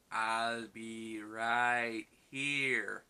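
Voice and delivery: very slow, drawn-out alien voice; E T's farewell. Retold or imitated. E T to Elliot at parting, very slow and drawn out. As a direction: stretch every word out like E T saying goodbye. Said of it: "points at Elliot's heart and goes".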